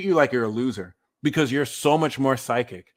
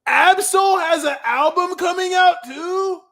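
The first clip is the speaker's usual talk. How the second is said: high, loud shocked outburst; surprised shout; 6 dB louder than usual. Real, not acted. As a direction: blurt it out in disbelief. Surprised at album news.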